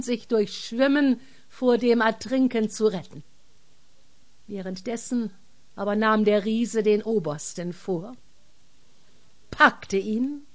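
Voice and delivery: ordinary delivery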